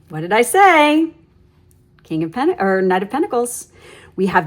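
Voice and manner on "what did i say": amazed. Real, not acted. Cards echo her earlier words.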